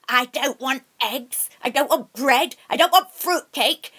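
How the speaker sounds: witch voice building to a loud, menacing demand; furious witch. Acted.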